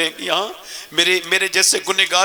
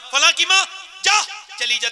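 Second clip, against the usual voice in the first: stern rebuke. Retold or imitated. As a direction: scold the mother sharply. Imam sends mother away. (سخت ڈانٹ)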